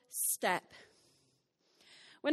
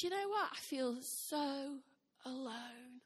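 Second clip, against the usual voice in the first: slow, much softer, fading sadly; sad confession; 7 dB quieter, slower than usual. Retold or imitated. Wife telling husband she feels alone, slow and hushed. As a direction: let it sink into a quiet admission. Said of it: "And I said".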